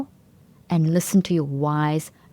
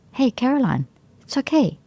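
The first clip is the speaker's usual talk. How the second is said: higher, gentle reassuring voice; kind self-talk; 7 semitones higher than usual. Shown as a demonstration. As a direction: soothe yourself like a kind friend. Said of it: "Rather say something like".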